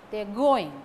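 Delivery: "going" punched loud, "they are" kept weak; stress on going. Shown as a demonstration. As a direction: hit "going" hard. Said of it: "Stress falls on going".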